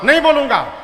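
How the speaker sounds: loud stubborn no; defiant refusal. Acted. Atheist son refusing the guru, loud and defiant. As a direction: dig in your heels and shout back.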